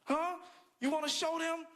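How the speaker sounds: high rallying voice, pumping up a crowd; firing them up. Pretend. Comic rallying friends to boycott lotion, fired up. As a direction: hype them up like an organizer. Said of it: "I'm getting them all fired up like".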